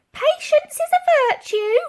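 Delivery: very high, loud sing-song call; old lady stalling the police at the door. A narrator's reading. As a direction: call sweetly to stall them. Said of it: "she called back".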